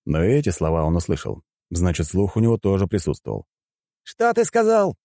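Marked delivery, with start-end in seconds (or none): 4.0-5.0 very high-pitched outraged cry; indignant yell. A narrator's reading. Fighter overhears the hero's taunt, yelling back. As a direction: yelp it in outrage. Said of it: "завопил он".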